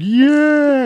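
high, loud cheer; hammed-up hurrah; mock crowd cheer. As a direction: imitate a crowd cheering the hero. Crowd cheers the hero, acted.

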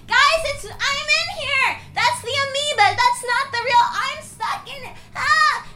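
high panicked squeak; trapped and panicking. Retold or imitated. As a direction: cry out from inside your body. Trapped self calling out.

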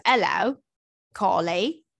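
greeting in a put-on accent, H dropped from Hello. Shown as a demonstration. Podcast host redoing an email's greeting, put-on accent. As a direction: put on the accent, "Carly" stretched to end in "-ay". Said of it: "Do you do the accent".

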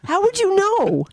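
indignant. Real, not acted. Mock-indignant retort.

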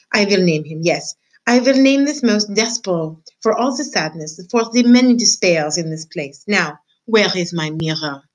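put-on lightly French-accented grand pronouncement; name him with mild French flair; French mother naming. French mother mouse names her son, light French accent. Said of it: "She was a French mouse".

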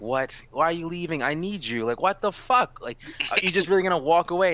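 outraged disbelief. Retold or imitated. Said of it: "And I was like".

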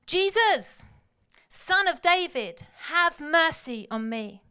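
loud, slow desperate cry; shout. Retold or imitated. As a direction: cry out for mercy as loud as you can. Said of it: "he began to shout".

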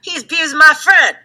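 high, mock outraged agreement; indignant friend. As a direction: play up the protective friend's outrage. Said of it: "You're like".

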